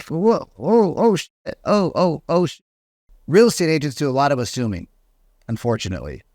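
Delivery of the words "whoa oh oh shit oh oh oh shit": high, stammering oh-shit panic; flustered shock. Retold or imitated. Agent realising his blunder, high flustered panic. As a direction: scramble like you stepped in it. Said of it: "you know, like".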